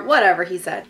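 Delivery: normal talking voice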